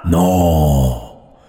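very low, slow rasping demon; raspy devil. Acted. Devil appears to whoever summoned him, deep raspy voice. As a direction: growl it slowly from the depths. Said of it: "Qué voz tan rasposa".

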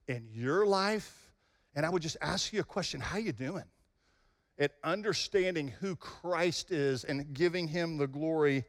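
low, soft and slow; quiet sincere appeal. Real, not acted. Preacher asking the congregation to reflect, soft and sincere. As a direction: ask it gently, heart to heart. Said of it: "quiet reflective close".

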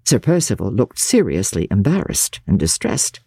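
usual speaking voice

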